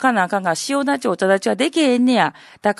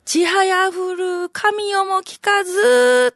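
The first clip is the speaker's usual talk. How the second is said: slow, drawn-out high chanting of the verse; stately slow poem chant; poem recital; 9 semitones higher, much slower than usual. Shown as a demonstration. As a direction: intone it like a classical waka reading. Old man recites the poem.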